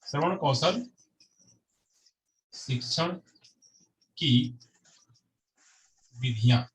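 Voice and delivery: long pauses between words, as if writing; word-by-word dictation. Real, not acted. Teacher gives the class a heading to note, slow dictation. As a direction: spell out the heading one word at a time.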